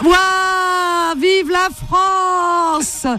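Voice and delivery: long drawn-out victory shout; excited cheer. Real, not acted. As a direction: cheer it like a stadium crowd. Celebrating France's goal live. (cri de victoire prolongé)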